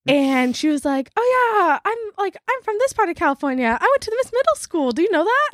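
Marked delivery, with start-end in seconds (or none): 1.1-5.5 high-pitched, gushing retold chatter; chatty imitation. Woman chatting up a fellow Californian, chatty high-pitched imitation. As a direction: mimic an eager stranger, much higher. Retold stranger's eager small talk.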